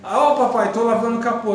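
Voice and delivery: playful imitation of a child's shout; child calling dad. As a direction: holler proudly to dad like a small kid.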